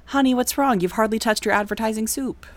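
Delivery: high, sweet fussing mother; doting parent at dinner table; sitcom mom voice. Acted.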